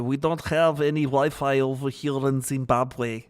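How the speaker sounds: attempted Zimbabwean man's accent. Streamer imitating a dude from Zimbabwe, mock Zimbabwean accent. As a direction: put on a rough Zimbabwean accent. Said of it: "trying to do a dude from Zimbabwe".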